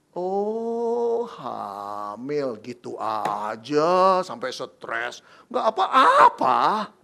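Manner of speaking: slow, drawn-out mock casual shrug; mock nonchalance. Preacher mocks an unrealistic carefree reaction from Joseph. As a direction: overplay the carefree answer ironically.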